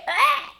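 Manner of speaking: high-pitched reaction shriek; mock scream. Viewer reacting to a savage comeback, mock scream. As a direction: let out a short, comic high yelp.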